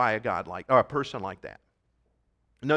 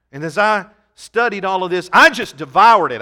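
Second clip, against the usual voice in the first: louder, building to a shout on devoured; preaching at full force; 3 semitones higher, 11 dB louder than usual. Real, not acted. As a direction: drive it home to the congregation. Preaching climax.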